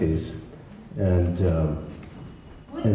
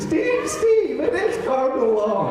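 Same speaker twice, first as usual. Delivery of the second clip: frantic wife; slower than usual. Retold or imitated. Wife running down, panicked.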